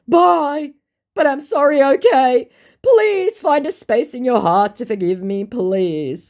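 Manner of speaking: high, loud begging voice; hammed-up pleading scammer. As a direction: beg for forgiveness theatrically.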